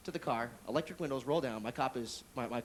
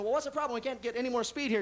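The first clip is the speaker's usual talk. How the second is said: talking to the car; 6 semitones higher than usual. Acted. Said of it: "so I'm saying".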